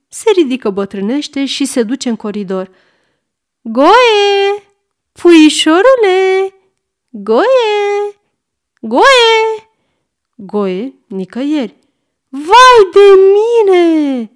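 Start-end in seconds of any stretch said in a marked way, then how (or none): 3.6-9.7 high, loud drawn-out calls; anxious calling out. A narrator's reading. Grandmother calls missing boy. 12.3-14.3 high, loud, long-drawn wail; panicked scream. A narrator's reading. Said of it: "țipă cucoana".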